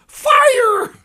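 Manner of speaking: very high, very loud shout; shouted fire. Retold or imitated. Third prisoner yelling at the firing squad, huge high shout. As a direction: scream it like a fire alarm. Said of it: "he goes".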